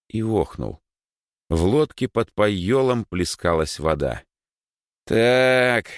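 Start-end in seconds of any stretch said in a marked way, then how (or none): 5.0-5.9 loud, high, drawn-out warning; ominous drawl. Acted. Rower notices water in the boat, ominously. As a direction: stretch it out with menace. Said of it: "зловеще протянул Егор".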